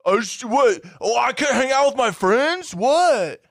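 high, loud whiny denial; mock Barry. Barry brushing off Heather's accusation, high whiny mockery. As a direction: play the innocent jerk.

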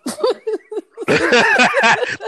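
big burst of high laughter; loud high laugh. Real, not acted. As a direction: cackle out loud. Laughing at the screen.

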